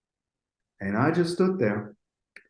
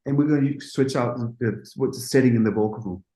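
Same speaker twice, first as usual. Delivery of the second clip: ordinary talk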